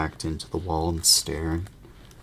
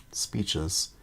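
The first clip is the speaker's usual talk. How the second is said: usual speaking voice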